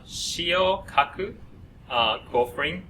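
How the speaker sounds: ordinary talk, nothing marked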